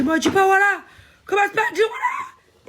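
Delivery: high, loud mocking shout with a laugh; hammed-up furious driver shouting; mock outburst of a driver losing it; imitating an angry yell. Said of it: "il commence à péter un plomb".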